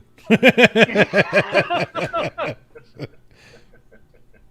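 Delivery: a loud, high, long laugh; long, loud howl of laughter. Real, not acted. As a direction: crack up at your own punchline. Laughs at own punchline.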